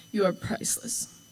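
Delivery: real tears, words barely voiced; tearful whisper. Real, not acted. Thanking her mom through real tears, nearly whispered. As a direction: choke out the love through crying. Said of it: "I'm hopefully done crying now".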